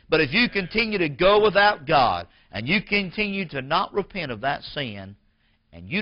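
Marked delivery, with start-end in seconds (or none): 0.1-4.6 preacher's warning. Real, not acted. Preacher warns the congregation.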